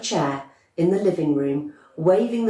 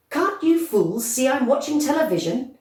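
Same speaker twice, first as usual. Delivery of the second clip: angry; 8 semitones higher than usual. Acted. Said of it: "he said angrily".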